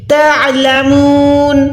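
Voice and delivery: تعلمون ending in a still nun; slow recited تعلمون; stop on silent nun. Shown as a demonstration. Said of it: "ساکن میں convert ہو جائے".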